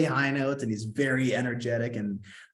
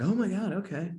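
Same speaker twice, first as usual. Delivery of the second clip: high-pitched excited gasp; excited disbelief; 10 semitones higher than usual. Retold or imitated. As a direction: squeal it, thrilled and stunned. Reacting to final callback news.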